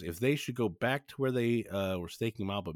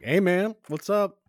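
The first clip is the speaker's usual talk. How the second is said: breezy greeting; 10 semitones higher, 7 dB louder, slower than usual. Retold or imitated. Daniel barges in casually.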